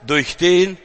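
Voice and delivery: usual speaking voice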